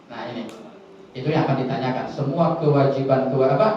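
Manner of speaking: ordinary talk, nothing marked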